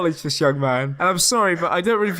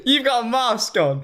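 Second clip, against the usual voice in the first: ordinary talk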